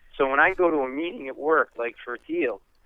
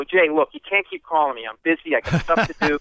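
loud mock-annoyed brush-off; mock exasperation; 4 semitones higher, 6 dB louder than usual. Lawyer mock-scolding Leno on the phone, loud. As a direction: shoo off a celebrity, mock fed up.

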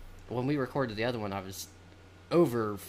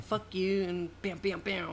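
mock gangster; 8 semitones higher, slower than usual. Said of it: "And he was like".

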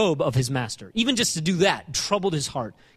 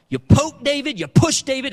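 higher and louder, pressing each phrase; rising intensity; 8 semitones higher, 5 dB louder than usual. Real, not acted. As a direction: push the congregation with it. Preacher builds to climax.

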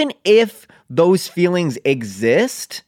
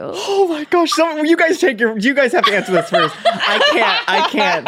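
high, excited, laughing; excited squeal; 11 semitones higher than usual. Real, not acted. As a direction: gush with delight. Overwhelmed by juicy question.

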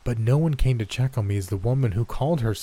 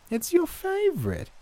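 high sugary granny; high old woman voice. A narrator's reading. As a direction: coax like a sweet old lady. Gran voiced.

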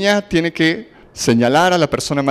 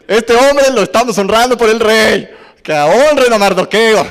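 louder and higher, a herald calling through the streets; mock proclamation shout; 5 semitones higher, 5 dB louder than usual. Amán forced to proclaim Mardoqueo's honor, shouting. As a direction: announce it to the whole city. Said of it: "Ya lo trae gritando".

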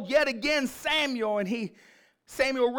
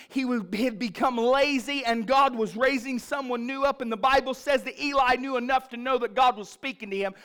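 ordinary delivery

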